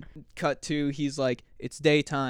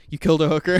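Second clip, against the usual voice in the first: louder mock-alarmed accusation; shocked blurt; 2 semitones higher, 9 dB louder, much faster than usual. Manager on the phone, mock alarm, louder. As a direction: blurt it out like he can't believe it.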